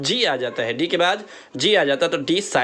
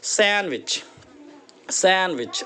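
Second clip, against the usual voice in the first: Sandwich as "SAN-wich", higher on SAN, no D; stress on first syllable; 3 semitones higher, slower than usual. Shown as a demonstration. Teacher shows where the stress mark falls, first-syllable stress on sandwich. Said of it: "जोर देकर कहना है".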